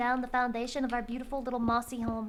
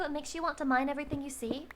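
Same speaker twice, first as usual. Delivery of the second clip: usual speaking voice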